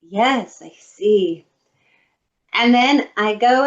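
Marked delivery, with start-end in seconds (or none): 0.0-1.5 lower, louder curt "Yes, I see"; stiff stranger. Retold or imitated. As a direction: play the unimpressed lady behind her. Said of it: "And she goes".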